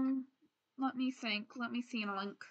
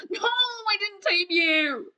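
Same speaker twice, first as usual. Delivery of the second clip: high, drawn-out mock wail; 8 semitones higher, 4 dB louder, slower than usual. Failed taming of the wolf.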